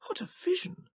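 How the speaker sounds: high, soft voice of awe; a hushed older gentleman marvelling; Moses amazed. A narrator's reading. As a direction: marvel at it quietly.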